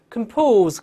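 "compose" with reduced "kəm", loud stressed "POSE"; weak first syllable. Shown as a demonstration. Teacher showing the unstressed prefix, weak first syllable. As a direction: hit "POSE" high and loud after a light "com". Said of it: "轻读时你看是".

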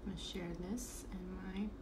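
soft, half-whispered muttering while busy; murmured to self. Real, not acted. Host sharing the stream to her group, murmured to self. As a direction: think aloud very quietly.

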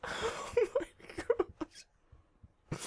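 high, airless, near-voiceless squeak; freaking out. Real, not acted. Gamer hiding from the guy, terrified squeak. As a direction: squeeze it out, breathless with fright. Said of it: "I'm freaking out right now".

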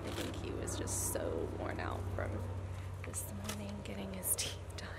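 soft whisper so he stays asleep. Real, not acted. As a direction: whisper it quietly. Child asleep, mom whispers.

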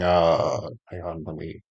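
ordinary talk

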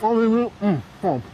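talking through a mouthful of food; chewing while trying to talk; mouth full. Real, not acted. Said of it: "you got me talking with my mouth full".

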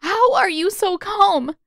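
high, loud, slow anguished outburst; bursting out. A narrator's reading. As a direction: blurt it out in distress. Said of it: "she burst out".

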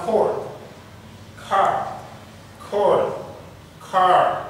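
cord with the vowel of court, car with an open a, said one after the other; minimal pair drill. Shown as a demonstration. Teacher to listening students, cord and car contrasted. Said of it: "C'est pas la même chose".

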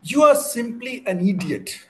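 high, loud outburst of exasperation; loud, high-pitched mock scolding; scolding wife. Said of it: "I got to hear this from my wife".

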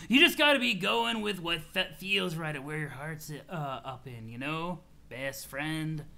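easygoing, halting stoner advice; laid-back troll, sincere. Acted. Troll advises his best friend to follow his heart, laid-back.

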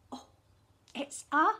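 usual speaking voice

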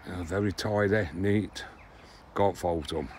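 usual speaking voice

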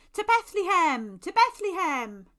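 high, very loud rallying call; ringing shout to set off; rallying cry. A narrator's reading. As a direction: call the whole party onward.

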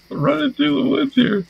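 very high playful sing-song; silly high voice. Pretend. Player running through the woods, very high playful voice. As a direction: chirp it up high, playing dumb.

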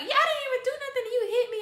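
high, fast mock whine; whiny little girl. The little sister complains that she got hit.

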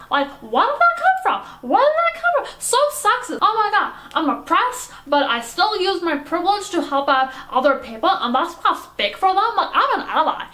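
high-pitched, hammed-up outraged rant; triggered Karen. Karen complains to the host about being called names, high outrage. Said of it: "a friend of mine who is very triggered".

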